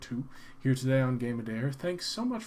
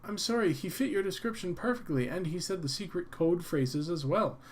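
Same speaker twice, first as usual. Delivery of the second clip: higher pleading female voice; frightened woman; 4 semitones higher than usual. Acted. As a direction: apologize in a high nervous voice. Frightened clerk apologizes.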